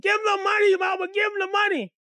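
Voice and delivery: put-on very high child's shout; high, insistent acted plea; twelve-year-old girl. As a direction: shriek it like a young sister. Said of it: "she's only around twelve".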